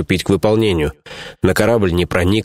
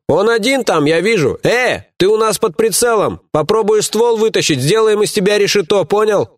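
угрожающий крик; 12 semitones higher than usual. Acted. Угрожают пленнику под прицелом.

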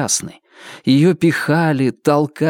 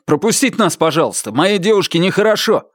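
high, urgent request; worried, pitched-up appeal; exclaiming; 6 semitones higher than usual. A narrator's reading. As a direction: press the crowd to let you through. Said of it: "воскликнул Джонни".

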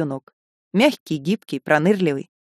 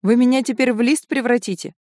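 shaky voice; 5 semitones higher than usual. A narrator's reading. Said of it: "дрожащим голосом".

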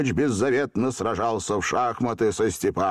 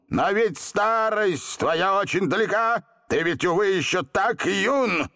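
angry shout; 10 semitones higher than usual. A narrator's reading. Genie objecting that the boy is young, angry shout. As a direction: stretch the words out in outrage. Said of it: "побагровев от злобы, крикнул".

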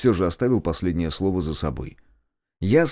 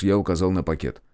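usual speaking voice

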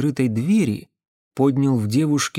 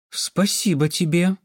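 mildly hesitant, slightly higher reply; unsure; 4 semitones higher than usual. A narrator's reading. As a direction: answer with a touch of uncertainty. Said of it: "неуверенно ответила девушка". (слегка неуверенно)

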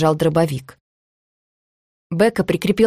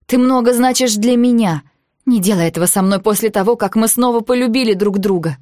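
higher, louder, urgent entreaty; heartfelt plea in a raised voice; emotional plea; 5 semitones higher, 4 dB louder than usual. A narrator's reading. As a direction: plead with a loved one, voice raised. Recalled emotional plea of Becca.

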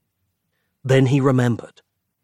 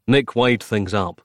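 usual speaking voice

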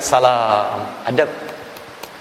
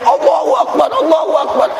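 high, ringing cry of joy; joyful shout; 10 semitones higher than usual. Retold or imitated. Healed Egyptian man shouting as his sight returns, joyful cry. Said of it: "Dia teriak".

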